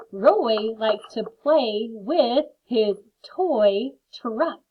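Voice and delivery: very slow and carefully spaced; teacher reading slowly to young readers. Shown as a demonstration. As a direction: read it out slowly for children. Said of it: "slow sentence reading for class".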